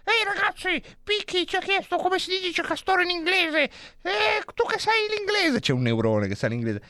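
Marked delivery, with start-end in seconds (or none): 0.0-5.6 very high, squeaky little neuron; high cartoon neuron chatter; tiny neuron voice. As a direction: voice the neurons as small cartoon creatures. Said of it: "i neuroni che parlano".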